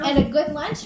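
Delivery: high, loud boast; defiant child. Acted. Fudge backing up Daniel against parents, defiantly. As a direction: chime in with cheeky defiance.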